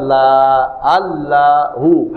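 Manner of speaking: "লা, আল্লাহু" slowly, each "লা" held long; long vowel demo. Shown as a demonstration. Teacher spelling out the word's long vowel, slow drawn-out syllables. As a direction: stretch the aa of "লা" and "আল্লাহু". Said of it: "লামে দীর্ঘ আকার".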